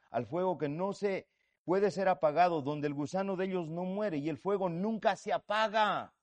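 fervent sermon reading; scripture reading. Acted. Preacher reads scripture aloud.

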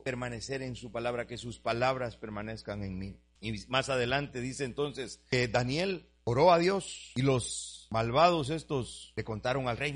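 normal talking voice

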